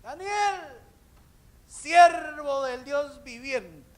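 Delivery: very high, drawn-out holler; slow, high, anguished cry; long calling shout. Acted. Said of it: "él gritó".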